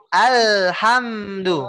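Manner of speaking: very slow Alhamdu, the L held a fraction of a second; pressed Al. Shown as a demonstration. Teacher showing the student the pressed Al, very slow. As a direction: hold the L of Alhamdu a moment. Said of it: "Dikasih penekanan beberapa ... sepersekian detik".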